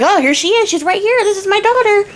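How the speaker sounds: high, eager, fawning father; the miller shows off his daughter to the guards, high and eager; eager dad voice. Retold or imitated. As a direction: push your daughter forward proudly, high-pitched. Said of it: "And Miller's like".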